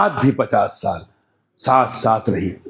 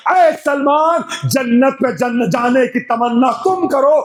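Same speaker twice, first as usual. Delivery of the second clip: impassioned majlis preaching; 10 semitones higher than usual. Acted. Preacher opening the majlis to the mourners, impassioned. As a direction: declaim it to the mourners at full force.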